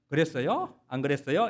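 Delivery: Seoul way with a polite rising 요 at the end; 서울말 흉내; Seoul speech. Retold or imitated. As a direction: mimic a newcomer faking Seoul talk. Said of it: "이렇게 말하는".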